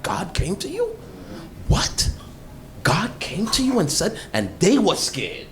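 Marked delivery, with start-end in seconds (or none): 0.0-4.2 mock surprise. Acting out scared people's reaction.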